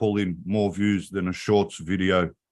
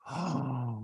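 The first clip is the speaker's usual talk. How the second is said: gasp; 4 semitones higher, 12 dB quieter than usual. Retold or imitated. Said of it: "wait for her reaction".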